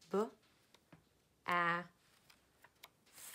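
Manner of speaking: separated sounds of "bath"; sounded out. Shown as a demonstration. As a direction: sound out each part of "bath". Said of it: "I'm gonna build the word bath".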